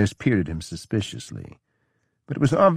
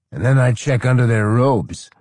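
louder, higher, boozy lecturing; higher, louder drunken counsel; loud drunk advice; 5 semitones higher, 6 dB louder than usual. A narrator's reading. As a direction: play the sloppy drunk giving advice. Drunk general leering joke.

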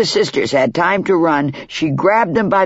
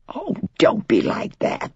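scolding; 8 semitones higher, 5 dB quieter than usual. Acted. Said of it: "the chicken scolded".

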